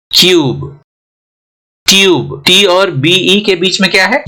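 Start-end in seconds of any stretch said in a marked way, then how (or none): 0.1-0.8 क्यूब with a drawn-out यू; long-u cube. Shown as a demonstration. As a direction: stretch the यू in क्यूब. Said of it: "Y O यू की तरह".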